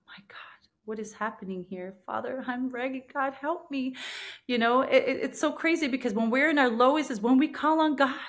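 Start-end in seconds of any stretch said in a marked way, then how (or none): 0.1-1.9 awed whisper. Retold or imitated. Stunned in the police car, whispering to herself. Awestruck inner words retold. 2.0-3.9 higher, softer plea to God; pleading prayer. Retold or imitated. Praying to God in the police car, softly pleading.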